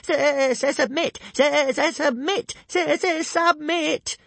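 very high, loud panicked squeal; top-of-the-voice little dragon shriek; stammering shriek. A narrator's reading. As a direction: shriek it with a stuttering start.

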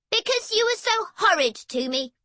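put-on high-pitched girl's accusation; aggrieved sister. A narrator's reading. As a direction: throw the blame back at a brother. Sister accuses her brother.

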